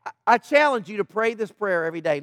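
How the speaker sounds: ordinary talk, nothing marked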